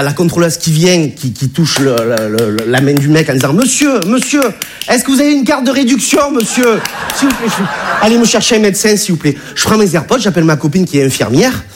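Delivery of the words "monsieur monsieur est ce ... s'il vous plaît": high-pitched insistent calling; hammed-up official urgency; urgent ticket inspector. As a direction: act an alarmed inspector trying to rouse someone. Ticket inspector calling to fainted man.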